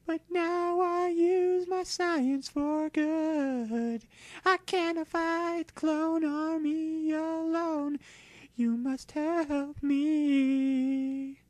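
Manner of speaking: high, slow psychic creature voice; a lofty legendary creature speaking slowly from up high; Mewtwo voice. Acted. Mewtwo tells the trainers of his past, high and slow.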